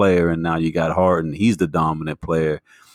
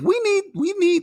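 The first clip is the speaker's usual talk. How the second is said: high insisting. Real, not acted. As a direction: pitch jumps way up. Insisting they read it.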